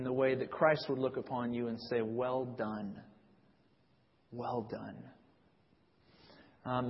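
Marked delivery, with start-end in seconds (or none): 2.0-5.0 much softer, low and tender praise; gentle approval. Pretend. Christ looking upon a faithful sufferer, softly praising. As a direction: say it like a gentle blessing, fading away.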